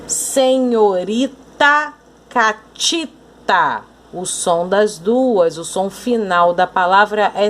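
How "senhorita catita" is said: Senhorita and catita drawn out slowly; rhyme demonstration. Shown as a demonstration. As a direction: stretch the endings of Senhorita and catita. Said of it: "vamos encontrar as palavras que rimam".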